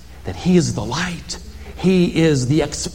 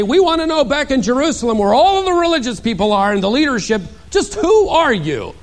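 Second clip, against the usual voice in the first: high, pressing priests questioning John; demanding delegation; 11 semitones higher than usual. Pretend. Priests from Jerusalem confronting John, demanding and pressing. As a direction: lean in hard on who.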